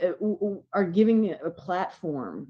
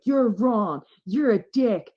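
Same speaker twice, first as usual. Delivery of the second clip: raised, louder telling-off; imagined outburst, punched higher and louder; mock scolding; 6 semitones higher, 6 dB louder, slower than usual. Speaker imagines punishing hateful people, scolding loudly.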